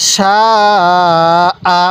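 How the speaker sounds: "সা" held very long, then a short "আ"; long madd. Shown as a demonstration. Teacher shows the four-alif rule, long drawn-out "সা" then short "আ". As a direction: stretch "সা" four counts before cutting to "আ". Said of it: "চার আলিফ টান হবে".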